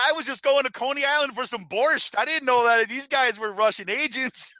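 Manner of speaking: high, innocent goofball voice; mock naive Carter Page. Joking as Page about the Brooklyn spies, high and naive. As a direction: act wide-eyed and blameless.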